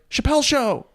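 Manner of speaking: high-pitched fannish outburst; starstruck kid. Acted. Young comic voices their inner fan, starstruck. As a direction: let your inner kid squeal the name.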